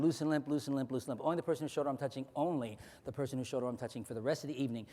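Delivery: low, soft hypnotist voice; hypnotic suggestion. Acted. Hypnotist giving a volunteer a suggestion, low and soft. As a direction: plant the suggestion gently.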